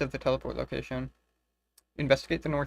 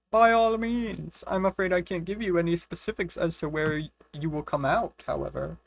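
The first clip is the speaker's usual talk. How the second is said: wizard voice; 4 semitones higher than usual. Acted. Voicing the wizard answering her request, hammed-up grand. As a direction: ham up the gracious old inventor. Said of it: "Your accents are the cutest".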